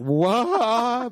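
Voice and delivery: high, loud stunned question; a kid's brain just broke; imitating a shocked son, high; stunned what. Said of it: "He was just like".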